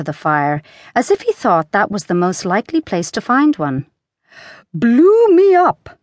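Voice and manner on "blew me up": high, slow, loud gasped-out words; panting. A narrator's reading. Breathless Queen explains to King, high panted cry. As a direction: puff it out loudly on a high pitch. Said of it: "panted the Queen, still a little out of breath".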